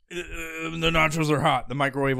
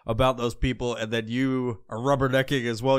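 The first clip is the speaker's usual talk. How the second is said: ordinary talk, nothing marked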